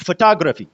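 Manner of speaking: Photography said fuh-TOG-ruh-fee; photography with second syllable strongest, falling after; stress on second syllable. Shown as a demonstration. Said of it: "ये सबसे prominent syllable है".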